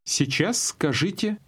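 ordinary talk

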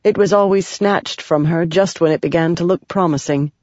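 ordinary delivery, nothing marked

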